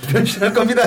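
high mimicry of a catchphrase. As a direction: echo the staged announcement mockingly. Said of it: "이건 뭐야?". (컨셉 말투를 높게 흉내)

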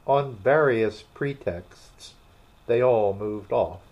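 plain reading voice

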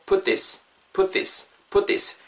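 "put this" run together as one word; naturally linked. Shown as a demonstration. Teacher models linking for learners, "put this" naturally joined. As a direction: link "put this" with no gap between the words. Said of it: "put this가 붙으면서 자연스럽게".

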